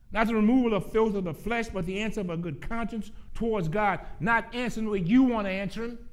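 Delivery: loud, high, emphatic preaching; full-force preaching. Real, not acted. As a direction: drive it home to the congregation, loud and high.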